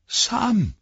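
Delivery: high loud call into fog. Acted. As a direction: shout a friend's name. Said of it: "rief er".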